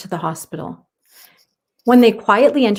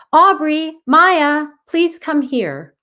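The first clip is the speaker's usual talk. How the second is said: higher, louder summons; calling; 7 semitones higher, 5 dB louder, slower than usual. Acted. Mom calling the girls back from play, calling out. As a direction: call the girls in from the water. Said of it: "Aubrey's mom called".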